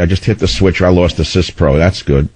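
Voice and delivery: ordinary delivery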